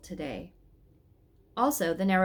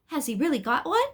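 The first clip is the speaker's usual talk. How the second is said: higher, louder, amazed question; astonished question; 7 semitones higher, 5 dB louder than usual. Acted. Elizabeth asks the angel about God's splinter, astonished. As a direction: blurt it out in wide-eyed disbelief.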